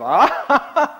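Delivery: loud, high belly laugh from the basement; hearty laughter. Retold or imitated. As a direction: laugh out loud with giddy joy. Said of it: "she hears sort of".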